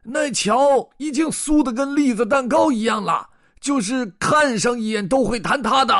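high, loud scolding shout; strained high old-farmer holler; farmer roaring. Acted. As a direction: yell the warning in exasperation. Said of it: "老农哈肯皮皮吼道".

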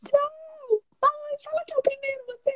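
high, soft, coy teasing; giggly late night call. Pretend. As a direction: coo it like a lovestruck caller. Couple-style goodbye game.

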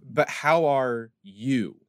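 slow, drawn-out "you" after a small pause; stress on YOU. Shown as a demonstration. Asking a second time because you care, stress on "you". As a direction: lean caringly on "you", stretching it out. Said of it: "depending on where I emphasize the word".

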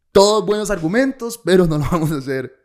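high, loud sarcastic praise; mock agreement. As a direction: concede grandly then refuse, hammed up. Retold mock concession, laughing between.

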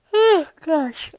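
yawning through words. Real, not acted. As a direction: talk mid-yawn. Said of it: "I'm sorry for yawning".

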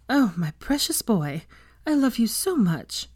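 slow opening, lifting high with feeling; fond, emotional motherly voice; doting. A narrator's reading. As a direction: pour out a mother's relief. Relieved mother doting on son.

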